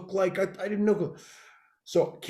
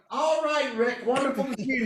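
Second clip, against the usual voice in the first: imitating Kenny; 8 semitones higher than usual. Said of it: "and Kenny's like".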